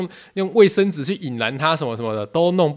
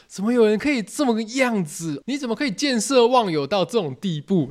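high, stunned indignation; how could anyone do this; outraged disbelief; 7 semitones higher than usual. Retold or imitated. The sweaty boys reacting to the betrayal, high and outraged. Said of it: "那种感觉".

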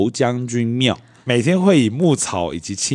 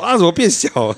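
dismayed cry; 9 semitones higher, 4 dB louder, faster than usual. Retold or imitated. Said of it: "他说".

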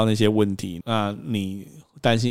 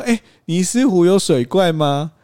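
high, slow, louder cutesy ask; playful question; 8 semitones higher, 5 dB louder, slower than usual. Retold or imitated. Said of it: "我就说".

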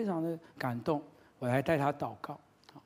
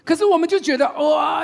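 high, loud exasperated outburst; loud, pitched-up cry of exasperation; shouting; 11 semitones higher, 11 dB louder than usual. Real, not acted. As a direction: erupt with frustration. Worked up about nagging.

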